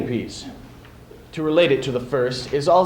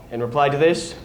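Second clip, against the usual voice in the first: plain reading voice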